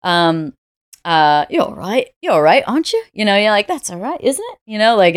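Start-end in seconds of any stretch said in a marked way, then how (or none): 1.5-3.0 put-on British accent, higher, rising tag question; light mock British, lifting the end into a question; playful British impression with a rising end; imitating British accent. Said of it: "Very British". 3.7-4.6 put-on British accent, pitched high, ending on a big rise; mock British, turning it into a question; hammed-up British tag question, high rise; imitating British accent. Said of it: "it's always a question".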